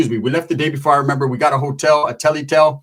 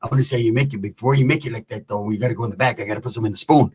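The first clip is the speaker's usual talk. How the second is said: low, soft come-on; flirting homie; 7 semitones lower, 3 dB quieter, faster than usual. Retold or imitated. Released homie coaxing tia to the back, low and soft. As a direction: coax her in a dropped homie voice.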